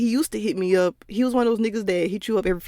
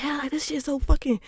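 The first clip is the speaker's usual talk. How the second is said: gushing; 7 semitones higher than usual. Retold or imitated. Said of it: "I was like".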